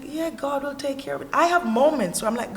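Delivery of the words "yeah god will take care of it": lightly voiced believer, higher pitch; imitating untroubled believer. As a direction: lightly mimic someone untroubled in their faith. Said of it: "one of those Christians like".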